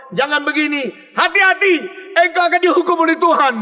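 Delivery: high, loud, fast warning; stern warning. Retold or imitated. Imitating a stern pastor warning the sinner, high and loud. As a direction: scold like a strict pastor. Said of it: "pendeta yang mengatakan kepada engkau".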